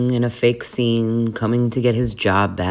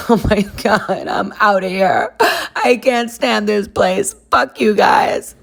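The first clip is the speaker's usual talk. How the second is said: high, hammed-up wailing exit; tearful outburst; 10 semitones higher than usual. Quitting on the coworkers, mock-tearful outburst. Storms out after being made to sign.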